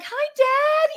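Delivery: squealed high greeting, thrilled; delighted greeting. Retold or imitated. Speaker greeting her late dad in signs, high excited squeal. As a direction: greet him like a happy child, very high. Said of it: "I'm just like".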